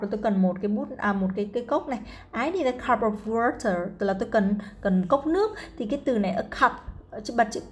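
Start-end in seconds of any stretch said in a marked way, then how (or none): 2.4-3.9 slow model sentence. Shown as a demonstration. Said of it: "slow model reading for learners".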